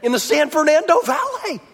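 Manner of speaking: lightly voiced awed little girl, drawn out; little girl in awe. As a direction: marvel at it like a small child. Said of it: "She's like".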